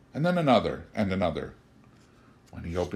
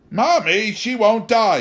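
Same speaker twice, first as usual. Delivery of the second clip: put-on high, loud child's yell; 12 semitones higher, 9 dB louder, slower than usual. A narrator's reading. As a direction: shout it like an upset little boy. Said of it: "he yelled".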